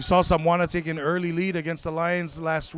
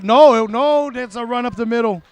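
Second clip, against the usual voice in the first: sudden loud, high correction; surprised play-by-play; 6 semitones higher, 8 dB louder than usual. Real, not acted. Commentator fooled by a fake jet sweep, surprised. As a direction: burst out as the play fools you.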